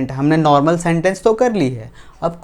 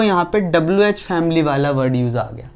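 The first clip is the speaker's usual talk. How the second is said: ordinary talk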